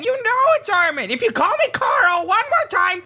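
normal talking voice

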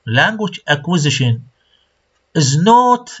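ordinary talk

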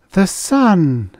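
high, loud cry of awe; awed. A narrator's reading. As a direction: exclaim it in wonder, pitched high. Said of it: "they said in awed voices".

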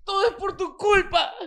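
high, whiny "todo es por tu culpa"; mock crying woman. Host imitates a woman turning the blame on him. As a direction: blame him like a tearful girlfriend. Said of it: "lo mejor es que te hace".

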